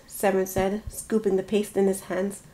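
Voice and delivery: ordinary delivery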